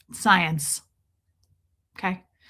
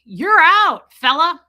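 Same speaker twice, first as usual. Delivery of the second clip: mock bouncer shout; 8 semitones higher, 12 dB louder, slower than usual. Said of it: "I was like".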